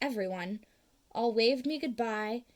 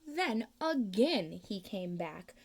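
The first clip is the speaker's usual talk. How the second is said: usual speaking voice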